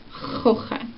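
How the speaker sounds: "kochen" with a soft unvoiced ch from the back of the throat, just air; whispered air in "kochen"; breathy German ch. Shown as a demonstration. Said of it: "You just do".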